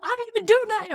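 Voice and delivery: high squeaky protest of innocence; mock whiny protest. Blamed classmate protesting to the teacher, high whiny mock protest.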